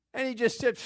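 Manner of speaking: normal talking voice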